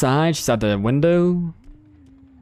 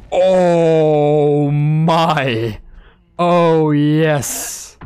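louder, drawn-out mock delight; mock savoring; 3 dB louder, much slower than usual. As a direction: relish the hit like a hammy villain. Savoring a hatchet hit.

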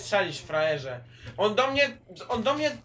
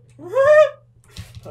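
burst of laughter; 19 dB louder than usual. Real, not acted. Laughs at friend's mishap.

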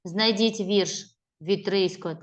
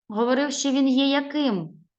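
usual speaking voice

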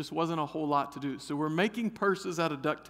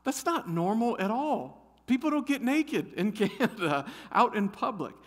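hint of the teacher; 7 semitones higher than usual. Canadian teacher answering the odd question, lightly voiced. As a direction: gently set him straight, smiling. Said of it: "she goes".

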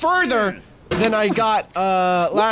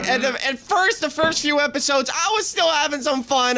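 ordinary delivery

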